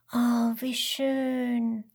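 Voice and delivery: slow, drawn-out wonder; enchanted child character; little koala marvelling. Acted. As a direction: stretch it out dreamily, as if thinking it. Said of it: "denkt der kleine Koala".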